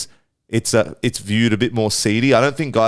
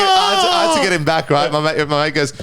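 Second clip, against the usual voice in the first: ordinary talk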